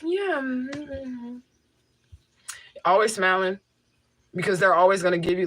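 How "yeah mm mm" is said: soft, very high-pitched sweet cooing; gentle feminine charm. Acted. Tenant charming the landlord, gentle high feminine cooing. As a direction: coo it high and sweet, trailing off quieter. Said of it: "when I talk to my landlord".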